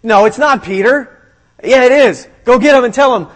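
hammed-up urgent back-and-forth; mock freak-out. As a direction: act out a flustered, excited exchange. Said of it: "freaks out and goes".

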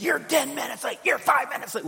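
high, loud scolding; scolding parent. Retold or imitated. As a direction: snap at the late kid like an angry parent. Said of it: "But if you lead with".

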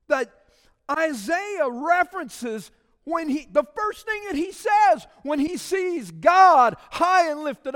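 high, loud, slowed preaching shout; fiery preaching. Acted. Pastor pressing the church about Isaiah's first words, fiery preaching. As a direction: drive the point home to the pews.

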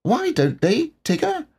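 small Roo asking with wide eyes; little Roo. A narrator's reading. Roo voiced high.

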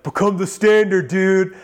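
high, loud sing-song mockery of the company name; mocking friends. Buddies texting the coach to tease him, mocking. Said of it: "they're like".

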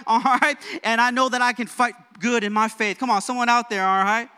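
high, loud, driving sermon delivery; fired-up preaching. Real, not acted. Preacher urging the church to fight for faith, fired-up preaching. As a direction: preach it with rising force, punching key words.

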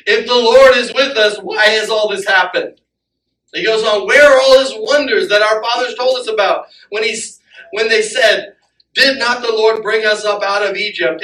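loud, indignant questioning; angry Gideon. Retold or imitated. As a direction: Gideon protesting in disbelief at the angel. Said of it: "you can see that he's kinda angry".

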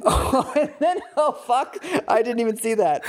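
high-pitched, cracking up; real laughing disbelief. Real, not acted. Co-host discovers the absurd last line of the passage, laughing in disbelief. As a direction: laugh through the words as it dawns on you.

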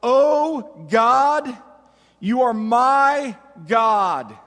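loud, slow declaration, each word held; crying out. Acted. David claims God in the wilderness, crying out boldly. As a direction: cry it out as a bold claim of trust. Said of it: "David cries out".

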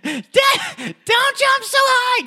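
very high, loud mock shriek; screeched falsetto imitation, drawn out at the end; mimicked mom yell. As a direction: parody a mom hollering a warning. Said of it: "Telling someone not to jump high".

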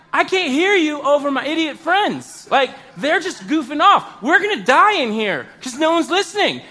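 fretting that they will die in there; panicky complaint. Retold or imitated.

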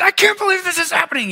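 hammed-up frustration at a disagreement; frustrated. Pastor acting himself arguing with his wife, high exasperation. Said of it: "you get all frustrated".

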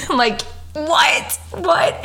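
high, airy laugh then squeaky "what? what?"; can't believe it happened, pitch shooting up; laughing disbelief. Real, not acted. Mother recalling giving birth roadside, high incredulous laughing "what?".

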